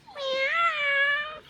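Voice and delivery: very high drawn-out meow; cat meow. Pretend. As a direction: mew like a kitten for the baby. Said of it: "The cat says".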